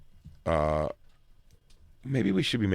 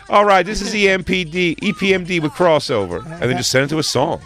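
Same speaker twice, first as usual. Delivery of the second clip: loud, high announcer voice; mock DJ intro; 10 semitones higher, 7 dB louder than usual. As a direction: hype the next song like a radio DJ.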